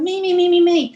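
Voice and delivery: put-on loud, high "me, me" like a kid begging to be picked; eager kid shouting. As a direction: shout it like a kid at the front of the class, hand waving. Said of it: "that little leery kid at the front of the class going".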